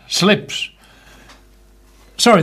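normal talking voice

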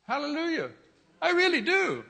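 high joyful hallelujah, really stressed; sudden burst of praise; excited praise. Real, not acted. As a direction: lift it up high with joy. Preacher exclaims in praise.

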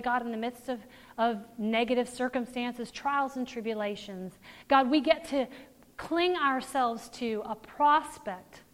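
soft, reverent closing prayer. Acted. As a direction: pray it quietly.

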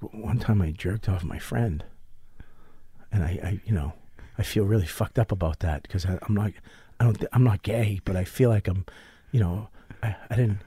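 low, soft, halting admission; hesitant confession. Retold or imitated. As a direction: confess a shameful secret under your breath, retold. Acts out his hesitant shameful confession.

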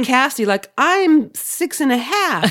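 mocking childish voice. Mocking church excuse.

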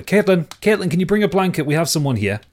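father yells to his daughter in the other room; yelling to another room. Acted. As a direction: call out to the next room. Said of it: "he yells away".

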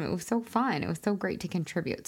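normal talking voice

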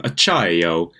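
slow, hammed-up Italian "acciaio"; lightly mock Italian "acciaio"; Italian accent. Shown as a demonstration. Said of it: "that's a terrible accent".

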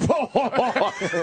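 high mock belly laugh as Barnes; imitated hysterical laugh. Showing how Barnes found the joke hysterical, imitated laughing. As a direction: act out Barnes breaking up laughing. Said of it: "he had to start laughing".

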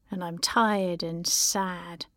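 ordinary talk, nothing marked

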